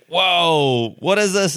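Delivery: high, wide-eyed gasp of wonder; amazed whoa. Retold or imitated. Founder reliving his first AR demo, amazed whoa. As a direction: relive the jaw-drop moment.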